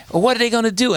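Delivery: imitated caller, high-pitched challenging question; caller with attitude. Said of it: "she calls up with attitude".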